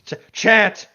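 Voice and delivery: loud, high barked order; shouted command. Pretend. Friend echoing Harry ordering her to chant, shouting. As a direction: yell the order over the laughter.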